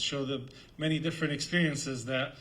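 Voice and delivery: usual speaking voice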